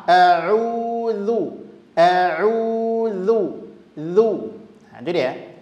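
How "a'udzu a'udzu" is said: a'udzu with rounded lips and tongue tip out; drawn-out a'udzu, lips rounded; rounded dzu. Shown as a demonstration. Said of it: "Zanya itu dhammah". (a'udzu dengan bibir monyong)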